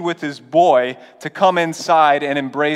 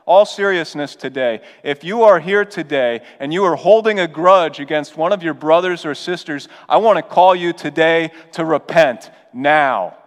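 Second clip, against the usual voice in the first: louder urgent call to repent; preaching; 3 semitones higher, 4 dB louder than usual. Acted. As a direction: press the congregation to act today. Pastor urging the congregation.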